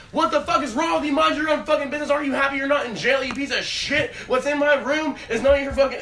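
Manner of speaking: loud, high-pitched furious tirade; acted-out angry yelling; mad brother. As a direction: imitate an irate sibling chewing someone out. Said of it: "Gets all fucking mad".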